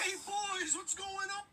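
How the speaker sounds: high put-on greeting voice; character voice.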